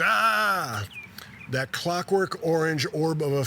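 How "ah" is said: high-pitched, drawn-out "Ah!"; a storyteller's contained scream of horror; mock scream. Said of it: "He screams".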